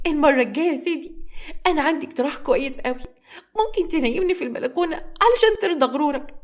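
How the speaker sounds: high, hurt and pleading; tearful. A narrator's reading. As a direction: argue through tears. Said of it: "والدموع نازلة على خدها".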